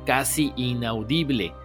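ordinary delivery

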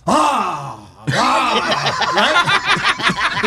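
high, loud mocking "¡Ah!" scream; mock angry yell. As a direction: scream like a startled angry man. Imitating the angry McDonald's worker.

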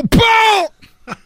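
high, explosive smack noise; blow sound. Acted. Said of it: "le volvió a pegar". (golpe imitado, agudo y explosivo)

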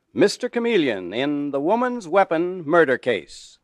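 ordinary talk, nothing marked

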